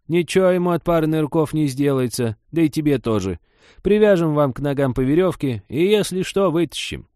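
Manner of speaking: high, loud breezy granny; pushy old woman. Acted. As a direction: brush off his worries. Old woman brushes aside his objection.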